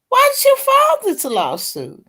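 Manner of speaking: a judge at the end of his patience, voice soaring high; retold judge, high and baffled; exasperated judge. Retold judge questioning the attorney.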